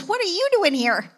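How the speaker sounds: shocked. Retold or imitated. Pastor retelling her shock at seeing Jackie, startled question. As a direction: blurt it out in shock. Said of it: "So my shock of shockers".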